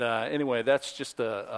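ordinary talk

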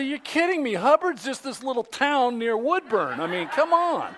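high, animated disbelief; retold incredulity. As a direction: protest in amazement at the absurd news. Retelling disbelief about the nearby town.

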